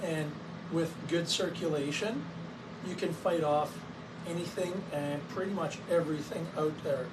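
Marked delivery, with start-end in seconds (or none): none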